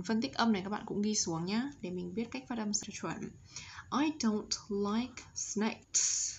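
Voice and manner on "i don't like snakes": slow model with a clear s closing "snakes"; careful teacher's reading, hissed s on "snakes"; final S sounded. Shown as a demonstration. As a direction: say it word by word, releasing the s in "snakes". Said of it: "S ending sound".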